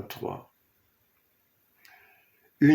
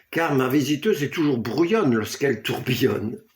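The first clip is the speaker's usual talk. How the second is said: usual speaking voice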